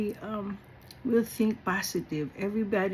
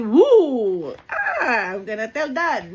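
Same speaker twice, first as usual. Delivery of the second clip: tattling sibling; 3 semitones higher, 6 dB louder, slower than usual. Retold or imitated. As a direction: catch your sister out. Sister caught cussing, tattling.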